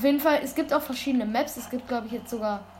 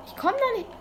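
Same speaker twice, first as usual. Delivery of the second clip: frustrated whine; 13 semitones higher than usual. Real, not acted. Stuck, frustrated.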